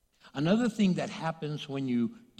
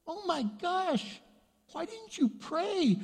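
much higher, exasperated husband voice; upset husband; slower than usual. Retold or imitated. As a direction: squeal it like a shocked, scolding husband. Husband still scolding.

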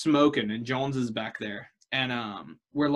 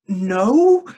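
a loud, higher, flat refusal; blunt no; 7 semitones higher, 9 dB louder than usual. Retold or imitated. Telling the knife-wielding friend no, loud and blunt. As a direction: shut the question down hard.

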